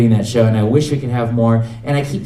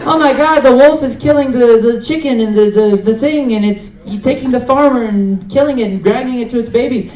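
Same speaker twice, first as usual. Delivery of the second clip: high, loud, panicked viewer outburst; mock horror; 11 semitones higher, 5 dB louder than usual. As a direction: imitate a hysterical viewer watching in horror. Voices a horrified viewer.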